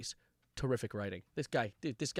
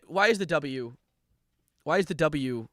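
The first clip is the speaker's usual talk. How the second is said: usual speaking voice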